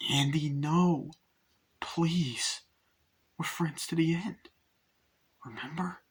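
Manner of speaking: high, slow, fading plea; Chucky pleading. Retold or imitated. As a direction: coax pitifully, sinking toward a whisper. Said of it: "and Chucky's like".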